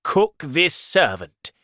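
slow, loud, cruel order; the king ordering a servant boiled; angry shout. Acted. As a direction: bark the punishment, drawn out. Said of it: "the king shouted".

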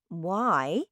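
ordinary delivery, nothing marked

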